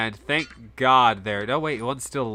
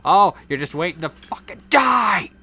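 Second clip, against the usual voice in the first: exasperated yell; 5 semitones higher, 6 dB louder than usual. Pretend. Player scolding idle troops, exasperated yell. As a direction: build to a loud, high shout on "die".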